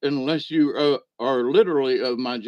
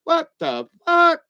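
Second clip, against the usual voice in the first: much higher, slow, loud stunned yell; shocked outburst; 13 semitones higher, 5 dB louder than usual. Retold or imitated. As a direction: let it burst out in disbelief. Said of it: "And Papa's going".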